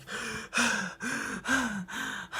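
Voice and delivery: high, breathy startled gasp; imitated gasp. Heroine hearing a noise, high mock gasp. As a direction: gasp like she hears something. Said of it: "and she's like".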